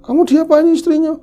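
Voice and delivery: high, loud accusing question; accusing mother. Retold or imitated. Mother accusing the son-in-law, loud high demand. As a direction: demand an answer from him.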